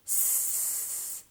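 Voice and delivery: long unvoiced sss for S; sustained hissing S sound; snake-like S. Shown as a demonstration. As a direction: hiss the S like a snake. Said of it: "like a snake would say".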